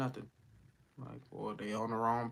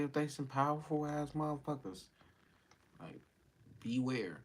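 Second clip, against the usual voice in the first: normal talking voice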